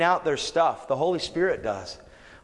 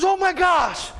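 astonished exclaim; 4 dB louder, slower than usual. Retold or imitated. Man feels liver healed.

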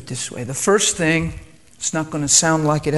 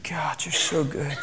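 whispered prayer, soft and slow; 8 dB quieter, slower than usual. Real, not acted. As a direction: breathe it to God as a private prayer. Private prayer mid sermon.

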